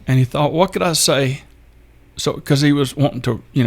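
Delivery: real emotion creeping into the telling; getting emotional. Real, not acted. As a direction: hold back tears as you recount it. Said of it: "I apologize for getting emotional".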